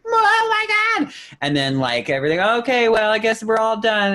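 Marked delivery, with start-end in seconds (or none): 0.1-1.1 very high, drawn-out excited squeal; slow, high-pitched gasp of delight; crowd's squeal. Retold or imitated. Crowd reacting to BB-8. 2.4-4.1 lightly voiced, higher mock resignation; pitched-up mock sigh of defeat; mock letdown. Mock crowd deflating.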